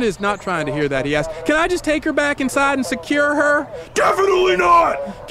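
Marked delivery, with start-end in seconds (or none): 1.4-3.7 higher-pitched pleading question; anxious request, pitched up; pleading dog owner. Acted. Owner pleading with armed cops. 3.9-5.3 high, barked police refusal; emphatic yelled no from a cop; shouted refusal. Acted. Cop refuses owner's request.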